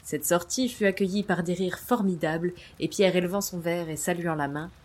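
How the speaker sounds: usual speaking voice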